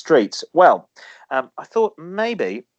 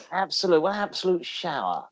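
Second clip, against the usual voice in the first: Leslie Phillips; 4 semitones higher, 6 dB quieter than usual. Retold or imitated. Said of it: "You went all Leslie Phillips as well".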